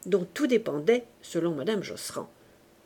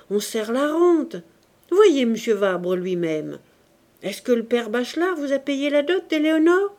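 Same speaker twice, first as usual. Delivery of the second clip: insistent; 5 semitones higher, 7 dB louder than usual. Acted. Uncle lecturing on dowries.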